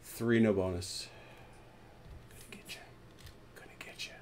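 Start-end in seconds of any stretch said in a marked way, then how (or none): 2.3-4.1 whispered playful threat. Pretend. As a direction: breathe it out teasingly.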